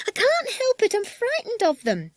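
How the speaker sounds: very high, panicky woman's voice; frightened woman. Acted. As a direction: wail it in a high fearful voice. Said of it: "said a woman".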